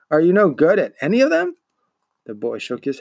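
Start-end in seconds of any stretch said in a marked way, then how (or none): 0.1-1.6 a touch of scornful disbelief, higher and louder; mock disbelief. A narrator's reading. Shopkeeper scornfully quizzing the boy, mock incredulous. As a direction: needle the boy with his failings.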